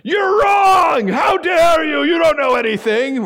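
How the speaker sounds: You're wrong!, How dare you? shouted in mock outrage; angry shout. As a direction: yell it down at someone from the pulpit. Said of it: "they didn't stand up and go".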